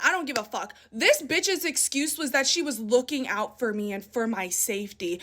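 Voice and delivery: ordinary talk, nothing marked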